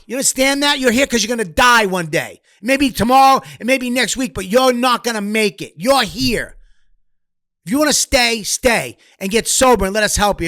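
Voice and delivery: high-pitched, hard-driving rant; impassioned angry tirade, imitated. Imitating the old guy trying to save a kid's life, angry tirade. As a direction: act out tough love at full tilt.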